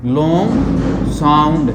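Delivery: "Long" drawn out very slow; stretched long sound. Shown as a demonstration. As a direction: stretch out "long sound" slowly. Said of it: "दूसरा होता है long sound". ("Long" को खींचकर धीरे बोलो)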